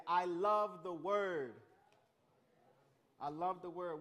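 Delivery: loud, drawn-out slow call to the room; call-and-response line. Shown as a demonstration. Youth pastor leading the group in a call, loud and slow. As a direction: belt it slowly so the room repeats it. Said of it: "high five your neighbor and say".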